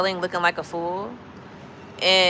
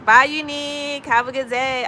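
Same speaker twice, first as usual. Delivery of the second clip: mock dismissive farewell; 5 semitones higher than usual. Acting out brushing someone off.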